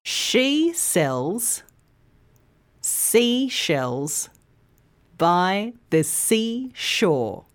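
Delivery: slow, word-by-word tongue twister with pauses. Shown as a demonstration. Teacher leads learners through the twister, very slowly. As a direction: draw it out carefully for learners to follow. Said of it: "start by saying it slowly".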